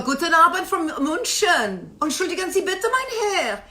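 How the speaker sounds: high, hammed-up German accent; theatrical mock-German courtesy; bad German accent. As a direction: put on an awful German voice. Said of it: "Don't make me talk in my German accent".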